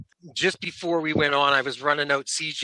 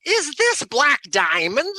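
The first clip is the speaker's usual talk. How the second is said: puzzled lady; 10 semitones higher, 4 dB louder, slower than usual. Retold or imitated. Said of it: "she goes".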